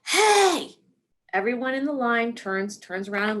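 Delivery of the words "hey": sharp loud high yell of hey; retold yell. Pregnant mom yells across the emergency room line, loud. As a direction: belt out hey to get attention. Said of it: "she yells".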